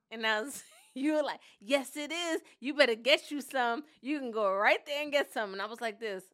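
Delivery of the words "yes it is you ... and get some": loud, emphatic friend imitation; mock enthusiastic, louder voice; imitated eager chattiness. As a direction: mimic an over-friendly recommendation. Friend's keen reply voiced in story.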